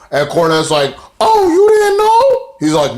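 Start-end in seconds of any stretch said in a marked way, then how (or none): 1.2-2.6 slow, loud falsetto taunt; taunting Cornette impression. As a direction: drag out the mock surprise, way up high. Retelling Cornette's taunting reply.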